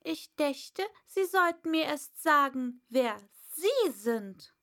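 put-on a girl standing firm; earnest Alice. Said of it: "und sprach sehr ernst". (ernstes Mädchen, laut und hoch)

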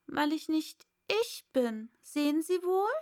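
put-on a flustered young girl; Alice. Alice voiced, higher. (hohe Mädchenstimme, aufgeregt)

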